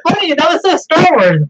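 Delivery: high, gushing delight over the movie scene; excited gush. Real, not acted. Friend gushing to co-host about a favourite scene, high and excited.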